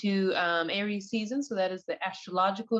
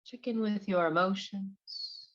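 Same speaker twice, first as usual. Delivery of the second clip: guided meditation. Acted. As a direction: ease them gently into relaxation, one unhurried phrase at a time.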